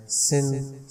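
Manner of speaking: usual speaking voice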